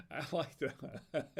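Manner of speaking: slow, laughing through the words. Real, not acted. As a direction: chuckle at your own joke as you say it. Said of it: "I'm laughing at my own dad jokes".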